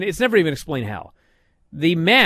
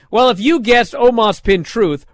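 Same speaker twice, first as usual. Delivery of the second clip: ordinary talk, nothing marked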